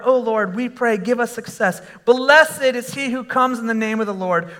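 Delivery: ordinary talk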